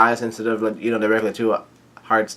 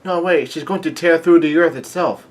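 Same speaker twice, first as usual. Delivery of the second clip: higher-pitched protest; mock alarmed yell; 6 semitones higher, 3 dB louder than usual. Nami warning the crew about Big Mom, mock alarmed yell. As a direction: blurt it out in mild alarm. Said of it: "Nami yells out".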